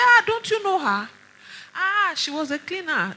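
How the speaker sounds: high, loud, astonished reveal; colleague's surprise. Retold or imitated. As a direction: exclaim it like big news. Colleague reveals her past.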